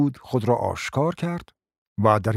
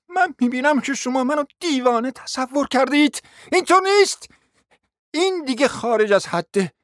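breathless; 13 semitones higher than usual. Acted. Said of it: "نفسش بند آمده بود، به زحمت گفت".

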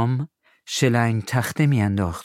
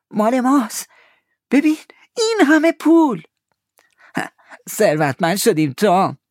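very high, loud delight; Huck's joy; 14 semitones higher, 6 dB louder than usual. Acted. Huck sees the gold and shouts with joy. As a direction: cry out with joy.

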